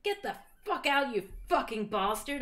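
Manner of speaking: hammed-up angry dismissal; mock yell. A narrator's reading. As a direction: order them out theatrically. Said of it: "I yielded".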